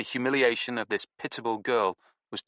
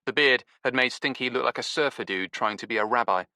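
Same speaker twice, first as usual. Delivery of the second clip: ordinary delivery, nothing marked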